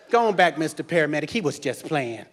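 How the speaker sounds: low, fast, hushed cover-up to the paramedic; mama shooing the medic away; lightly voiced mother; sweet-talking mama.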